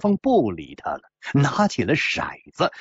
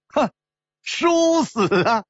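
high, drawn-out mock exclamation; gleeful punchline; 10 semitones higher, slower than usual. Gambler revealing the painless way to die, gleeful. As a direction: stretch it out high and triumphant.

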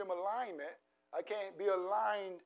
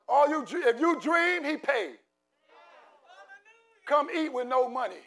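prophetic declaration; 2 semitones higher, 4 dB louder than usual. Acted. Pastor declaring blessing over the church, prophetic preaching. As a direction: declare it over them with conviction.

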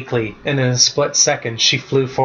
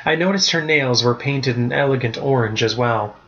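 plain reading voice

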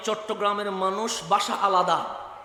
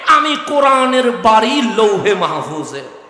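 higher, loud building sermon shout; preaching at full force; 5 semitones higher, 5 dB louder than usual. Acted. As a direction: build to a fervent climax. (উচ্চস্বরে ওয়াজের চূড়ান্ত গর্জন)

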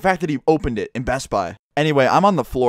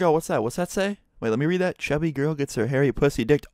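lightly voiced puzzled reader, higher pitch; imitating silent reading; 3 semitones higher than usual. Employee peering at the screen and reading the title, puzzled imitation. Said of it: "I bet he read it in his head".

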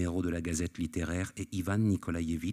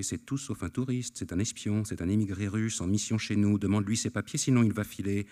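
ordinary delivery, nothing marked

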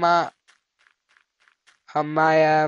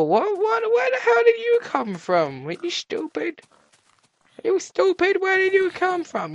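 high-pitched startled questioning; 13 semitones higher than usual. Real, not acted. Player challenging a creature that suddenly appeared, high and startled. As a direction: squeal the questions in surprise.

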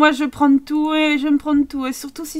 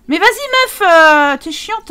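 higher, louder fed-up call; exasperated yell; 5 semitones higher, 10 dB louder, slower than usual. Real, not acted. Gamer yelling at a Sim who won't obey, exasperated. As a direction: snap at her, out of patience.

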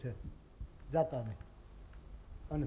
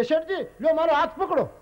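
loud, much higher offer of help; calling out; 11 semitones higher, 7 dB louder than usual. Retold or imitated. Second rescuer shouting to the merchant, loud high call. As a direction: offer your hand with a shout. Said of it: "કેમ કે".

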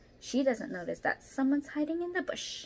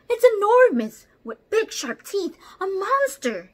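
high, much louder frightened elephant shriek; panicked shriek; 11 semitones higher, 14 dB louder than usual. Acted. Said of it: "he shrieks".

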